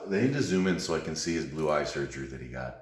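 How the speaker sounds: normal talking voice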